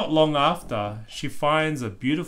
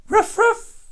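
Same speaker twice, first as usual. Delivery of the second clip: very high, loud puppy barks; playful barking; 9 dB louder than usual. Acted. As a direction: yap like a little dog chasing birds. Bluey barks at seagulls.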